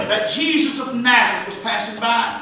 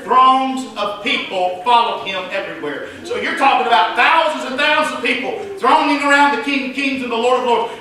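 ordinary talk, nothing marked

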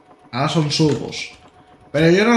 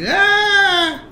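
high shouted eh, far above his own voice; imitating the Renfe worker; 5 dB louder than usual. Imitating the Renfe worker calling him over, shrill and loud. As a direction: mimic a worker waving him over with a yell. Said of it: "me hacía así con las manos".

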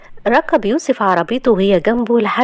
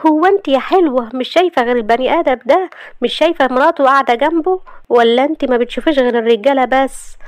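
jealous wife snapping at the flight attendant, pitched higher; mock-jealous scolding of a flirt; wife confronting the attendant, jealous and higher; 7 semitones higher than usual. A narrator's reading. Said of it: "قالت لها شهد بغيرة".

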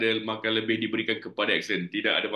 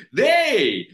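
loud, high Malaysian slang call; Malaysian slang "they"; 8 semitones higher, 7 dB louder than usual. Shown as a demonstration. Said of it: "jangan pakai they".

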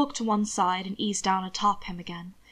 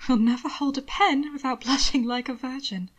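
Will voice; 3 semitones higher than usual. A narrator's reading. Will's voice, teasing, half whispered.

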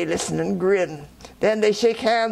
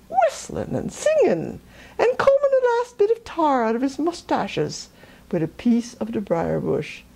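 ordinary delivery

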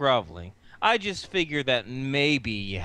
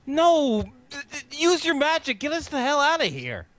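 exasperated yelling; 14 semitones higher than usual. Acted. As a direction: demand it in frantic exasperation. Exasperated character orders fairy.

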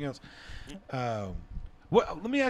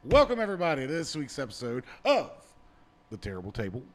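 announcer. Acted. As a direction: stretch the show title out with dramatic pauses. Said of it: "I'm doing my best announcer voice".

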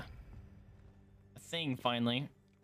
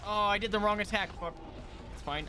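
normal talking voice

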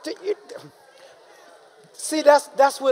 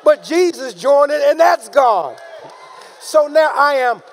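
loud, raised, emphatic sermon delivery; forceful preaching; 3 semitones higher, 5 dB louder than usual. Real, not acted. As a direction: preach it out, slowing for the big words.

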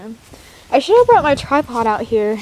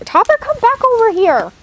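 calling out; 10 semitones higher than usual. Real, not acted. Calling a horse.

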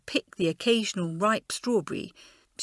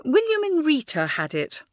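meaningful; 8 semitones higher, 4 dB louder than usual. A narrator's reading. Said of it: "with a meaningful look".

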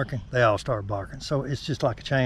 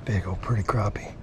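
hushed and fond; admiring whisper; 3 semitones lower, 6 dB quieter than usual. Real, not acted. Admiring the fish.

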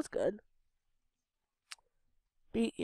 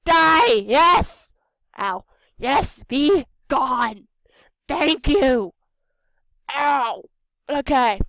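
triumphant shout; 3 semitones higher, 11 dB louder, much slower than usual. Real, not acted. Gamer winning the fight, loud triumphant yelling. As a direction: yell it out in victory.

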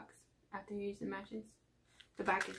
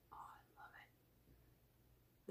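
slow, very soft whisper; 21 dB quieter, much slower than usual. Real, not acted. Whispering love for the little stickers. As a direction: breathe it out slowly.

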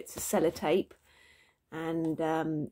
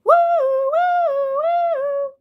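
high, loud siren-like "woo"; playful screaming whoop; 10 dB louder, much slower than usual. A narrator's reading. Baby runs away again, gleeful high scream. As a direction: whoop it in long, high waves.

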